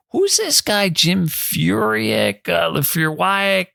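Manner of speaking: high, slow, baffled retelling of his younger self; puzzled. Retold or imitated. Young golfer asking buddy about a stranger, baffled and high. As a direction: stretch out the strange name in disbelief.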